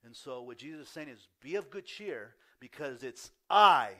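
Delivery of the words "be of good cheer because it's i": "I" boomed out very loud and drawn out; forceful stress on I. Shown as a demonstration. Jesus declaring himself to the disciples, "I" hit with great force. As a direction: build to a huge, slow, emphatic "I". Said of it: "it's really pronounced with force".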